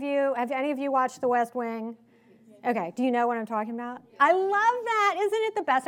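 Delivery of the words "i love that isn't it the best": much higher, excited gush; high-pitched, thrilled outburst; real delight. Real, not acted. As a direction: gush to fans who share your favourite. Delighted that audience knows show.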